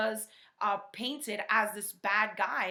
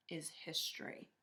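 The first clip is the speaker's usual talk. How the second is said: whispered aside; 5 semitones lower, 10 dB quieter than usual. Acted. Dramatic storybook ending.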